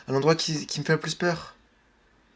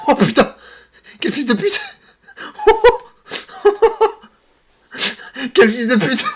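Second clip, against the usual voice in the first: startled cursing, higher and louder; scared swearing; 10 semitones higher, 6 dB louder than usual. Real, not acted. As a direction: shaken yelling after a fright. Jump scare in horror game.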